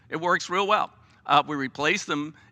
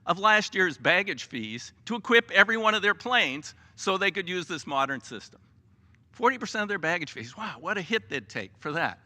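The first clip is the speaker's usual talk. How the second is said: usual speaking voice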